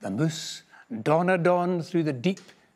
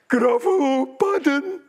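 high, loud, wailing disbelief; a monster suddenly terrified; gruffalo's horrified cry; 8 semitones higher, 5 dB louder than usual. A narrator's reading. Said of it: "the gruffalo grat".

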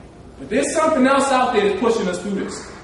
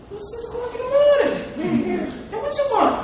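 mock sleepy complaint, pitched much higher; groggy annoyed; 13 semitones higher than usual. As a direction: friend woken in the morning, high mock grumble. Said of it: "hold on".

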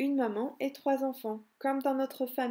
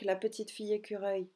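plain reading voice

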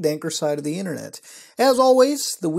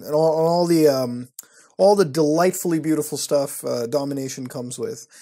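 ordinary talk

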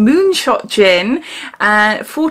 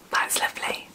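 hushed whisper of pleasure; 14 dB quieter than usual. Real, not acted. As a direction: breathe it out softly. Whispered tasting reaction.

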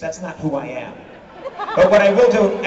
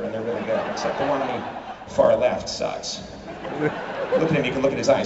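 usual speaking voice

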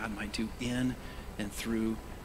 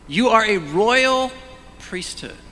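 proclaimed; 10 semitones higher, 11 dB louder, slower than usual. Real, not acted. Preacher proclaims scripture.

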